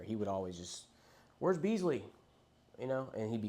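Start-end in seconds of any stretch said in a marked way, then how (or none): 1.4-2.0 where's he gone. Retold or imitated. Friend vanishing, everyone asking.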